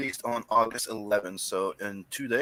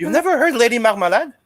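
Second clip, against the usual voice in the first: incredulous; 11 semitones higher, 9 dB louder than usual. Real, not acted. Incredulous at friend.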